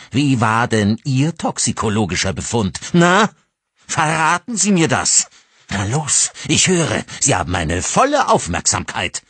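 high, loud mocking demand; taunting fury. Father taunting the driver about her test, loud and high. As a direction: bait her to answer.

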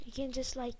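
hushed, fast whisper. Real, not acted. Gamer whispers to viewers while showing a jump. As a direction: breathe it out as you try the move.

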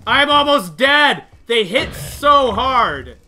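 loud, high, drawn-out wail; exasperated yelling. Real, not acted. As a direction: stretch out the complaint at full volume. Gamer wailing about hard hits.

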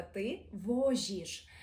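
«ти возіш» very slowly with a soft ж; teacher shows the soft ж; soft Polish sounds. Shown as a demonstration. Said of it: "Тут же говоримо м'якіше".

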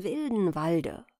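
plain reading voice

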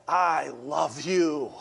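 slow, soft and intimate; tender whisper of love. Pretend. God speaking to us on the ledge, slow and soft. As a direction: let it land gently, almost whispered. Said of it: "and he says".

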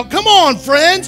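usual speaking voice

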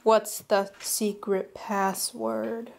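slow, hushed secret question; whispering a secret password. Pretend. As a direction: lean in and ask it quietly. Guard game asking password.

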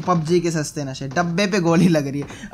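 ordinary talk, nothing marked

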